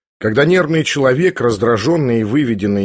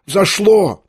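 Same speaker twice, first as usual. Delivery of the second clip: exclaiming again; 7 semitones higher than usual. A narrator's reading. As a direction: cry it out higher. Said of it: "вскричал он".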